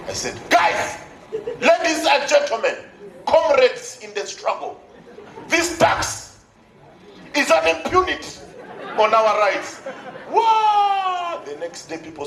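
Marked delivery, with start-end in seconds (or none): 0.5-9.8 slow, grand orator voice; hammed-up political speech, high; pompous rally speech. His own grand speech. 10.3-11.4 excited shout. Retold or imitated. Crowd cheering.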